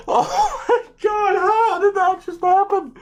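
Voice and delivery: high squealed disbelief with laughter; giddy shock. Real, not acted. Streamer reacting to a draft pick, high giddy disbelief. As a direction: squeak it out through a stunned laugh.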